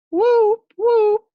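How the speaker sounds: very high, loud siren whoop; whooping siren imitation; siren wail. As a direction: wail like a fire engine siren. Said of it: "made the siren wail".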